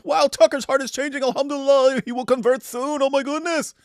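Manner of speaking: high-pitched gushing parody; mock excited fans. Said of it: "tons of Muslims".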